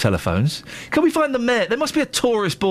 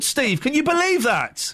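ordinary talk

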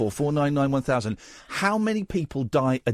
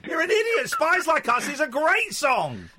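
high-pitched, mock-outraged yelling; exasperated shouting; 10 semitones higher than usual. Listener alone in his car arguing with a podcast, exasperated shouting. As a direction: shout back at the podcast, voice leaping up. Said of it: "in my car just shouting".

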